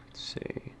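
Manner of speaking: whispered to himself; soft private whisper while searching. Real, not acted. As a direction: breathe it under your breath.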